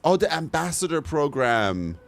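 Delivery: ordinary delivery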